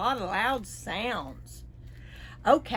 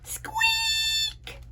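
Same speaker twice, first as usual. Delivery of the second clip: mouse squeak. Acted.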